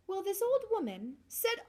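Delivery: plain reading voice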